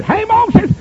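high-pitched hail; calling out. Retold or imitated. The Lord calling Moses, calling out. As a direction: call it out high, as if to someone off a way.

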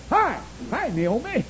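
put-on high-pitched child's greeting; piping little kid calling. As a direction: call out high like a small child. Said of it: "And some little child".

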